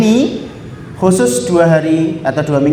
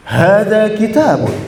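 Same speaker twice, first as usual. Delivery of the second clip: slow, lively Arabic demo; 3 semitones higher than usual. Shown as a demonstration. Teacher showing students how Arabs speak, excited. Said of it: "Excited gitu".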